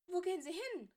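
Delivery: high, loud call after him; girl calling down the corridor. Acted. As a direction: call after the departing teacher. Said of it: "rief Granger ihm nach".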